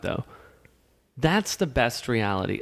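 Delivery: normal talking voice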